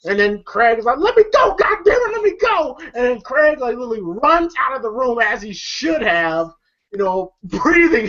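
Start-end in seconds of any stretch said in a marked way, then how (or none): 1.0-2.8 high panicked let me go; panicked plea. Retold or imitated. Craig pinned by his uncle, a high panicked plea. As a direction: squeal to be let go.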